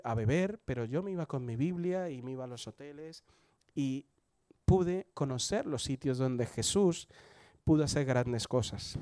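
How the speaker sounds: ordinary talk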